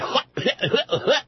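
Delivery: short high burst of laughter; amused high laugh. Real, not acted. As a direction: laugh at the joke just made. Laughs at own parody.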